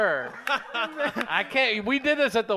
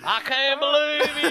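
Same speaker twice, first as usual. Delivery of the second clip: higher, put-on Southern accent; Southern guy; 5 semitones higher than usual. Comic doing his character for the hosts, Southern accent. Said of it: "that's good Southern guy".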